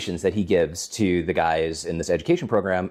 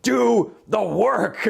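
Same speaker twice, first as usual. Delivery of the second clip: impatient demand; 13 semitones higher, much slower than usual. Retold or imitated. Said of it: "and I'm like".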